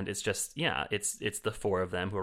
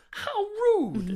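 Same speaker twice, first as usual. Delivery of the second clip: very high, loud mock-offended "How rude"; offended Judi Dench; 8 dB louder than usual. Retelling how Judi Dench sounded offended, squealed high. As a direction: squeal it, pretending to be scandalised. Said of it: "Judi Dench actually looked offended".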